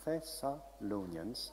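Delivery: careful, slow syllable by syllable; very slowly. Real, not acted. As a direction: sound out a tricky name slowly. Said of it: "I need to say it very slowly".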